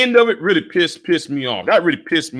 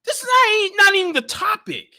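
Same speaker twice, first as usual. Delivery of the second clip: much higher, mock-exasperated pushback; high incredulous protest; exasperated retort; 14 semitones higher than usual. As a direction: squeak it out like you can't believe the topic change. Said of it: "And you'll be like".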